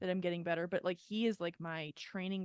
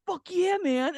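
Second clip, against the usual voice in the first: much higher, louder cheer like an enthusiastic stranger; hearty bro cheer; 11 semitones higher, 11 dB louder, slower than usual. Retold or imitated. Stranger in the sauna cheering her on, loud and higher. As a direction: cheer her on like a pumped-up guy.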